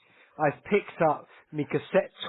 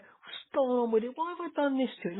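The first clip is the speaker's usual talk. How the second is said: dismayed surprise; 9 semitones higher than usual. Retold or imitated. Retold dismay at ruined keyboard.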